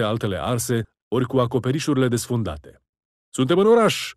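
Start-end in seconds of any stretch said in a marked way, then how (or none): 3.3-4.1 high, loud victorious yell; triumphant bellow. A narrator's reading. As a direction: yell it out as the walls fall. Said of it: "zbieră Daud".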